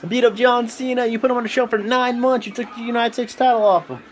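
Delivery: high, excited mock AJ voice; hammed-up impression of an impressed wrestler; imitating AJ. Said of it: "A J is like".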